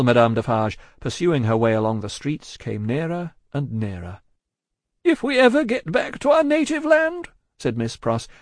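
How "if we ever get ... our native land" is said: high, hurried woman's voice; distraught genteel woman. A narrator's reading. Miss Pross cutting short Cruncher's vows, anxious. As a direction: pitch it far up, anxiously polite.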